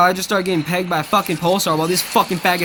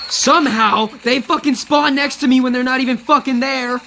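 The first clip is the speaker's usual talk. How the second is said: higher and louder, furious yelling; angry shout; 6 semitones higher, 4 dB louder than usual. Real, not acted. Gamer yelling at the game over enemy spawns, furious shout. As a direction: explode at the unfair spawn. Raging at enemies spawning nearby.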